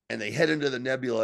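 ordinary talk

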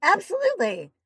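excited voice breaking on the word; voice cracking. Real, not acted. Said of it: "there's my voice just cracked".

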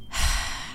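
long, heavy unvoiced sigh. Real, not acted. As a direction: let out a weary sigh. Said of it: "I'm sighing heavily".

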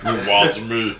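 low, crooned reggae catchphrase. Retold or imitated. Co-host echoes the hit after teasing, low reggae-singer imitation. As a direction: drop it low like the song's hook. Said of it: "Right, Shaggy".

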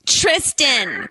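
put-on Valley girl drawn out. Shown as a demonstration. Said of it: "goes so much better with that voice".

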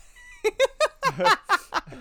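high, loud cackling laugh; real high-pitched belly laugh; burst of laughter. Real, not acted. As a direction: crack up loudly at the joke. Cracks up at table-read joke.

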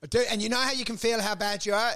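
ordinary talk, nothing marked

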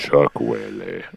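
low, slow DJ voice; FM radio voice. Pretend. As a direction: croon it like a late-night DJ. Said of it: "doing the F M radio voice".

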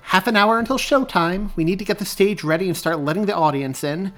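loud, high, brisk announcement; commanding director's call; calling out orders. A narrator's reading. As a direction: project instructions across a theater. Director calls orders after checking watch.

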